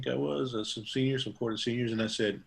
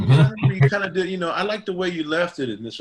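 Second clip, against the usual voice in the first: fast and much louder, pitched up; eager praise; 7 semitones higher, 9 dB louder, faster than usual. Retold or imitated. Relative praising his old haircut, fast and loud. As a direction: rush out the compliment.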